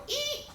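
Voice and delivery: very high, short squeal; sudden car-brake screech imitation; imitated tire screech. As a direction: squeal like braking tires. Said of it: "I heard a car go".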